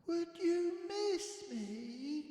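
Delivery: high, soft little voice; small complaining Boris. A narrator's reading. Sleepless cub calling to parents, high and soft. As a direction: fret quietly from bed.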